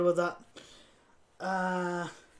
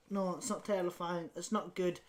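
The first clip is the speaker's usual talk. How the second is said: ordinary talk, nothing marked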